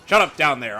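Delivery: shouting. Real, not acted. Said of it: "I'm trying to be epic".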